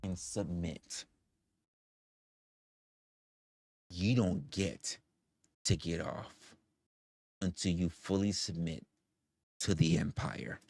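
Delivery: soft, slow sinister voice; menacing near-whisper. Acted. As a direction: purr the threat slowly. Villain taunting prisoner softly.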